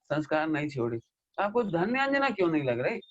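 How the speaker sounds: ordinary talk, nothing marked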